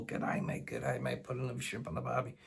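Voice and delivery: soft mock Aussie patter; hushed, put-on Australian accent. Brit playing tourist in Sydney, mock Australian accent. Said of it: "Sorry for the accent".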